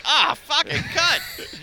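high, drawn-out comic swearing; mock cursing outburst. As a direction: curse in a hammed-up high voice. Mock outburst while cooking dinner.

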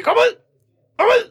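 repeated high-pitched yells; mock exorcist shout. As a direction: imitate someone ordering a demon out. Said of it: "kommer der folk og råber".

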